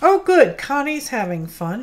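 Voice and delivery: normal talking voice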